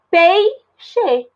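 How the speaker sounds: "peixe" split into pei... xe, the ei kept together; syllable by syllable. Shown as a demonstration. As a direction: pause between the two syllables of "peixe". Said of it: "eu separei".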